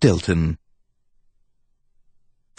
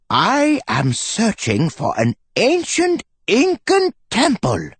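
put-on high, eager old scholar; professor voice; 14 semitones higher than usual. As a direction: announce the quest like a keen professor. Professor starts his tale.

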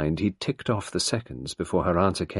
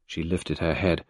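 ordinary delivery, nothing marked

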